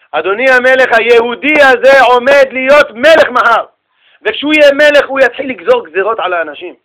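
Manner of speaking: acted forceful address; emphatic declaration. Sage solemnly pleads case before king.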